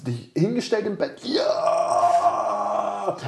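imitating a friend's wild joy; mock cheering. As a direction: play the friend jumping up in bed. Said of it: "hättest du dann nicht ins".

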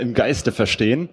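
ordinary talk, nothing marked

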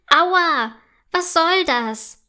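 offended cry of pain; indignant. A narrator's reading. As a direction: protest loudly at being landed on. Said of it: "ruft der Pilz empört".

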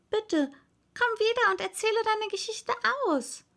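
put-on high, pleading girl's call; high-pitched entreaty called after someone. A narrator's reading. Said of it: "rief Alice ihr nach".

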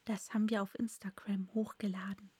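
soft, unvoiced whisper; whispered aside. Real, not acted.

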